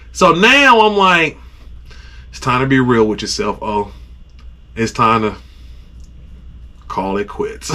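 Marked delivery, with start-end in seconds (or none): 2.3-7.7 low, soft self-talk with long pauses; resigned defeat. Retold or imitated. Angler admitting defeat to himself, low resignation. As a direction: give up quietly, pitch sinking.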